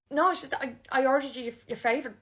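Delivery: ordinary talk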